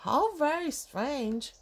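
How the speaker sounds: usual speaking voice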